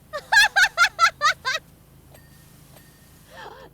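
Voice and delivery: loud shrieking laugh; loud high laugh. Real, not acted. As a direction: burst out laughing hard. Big laugh.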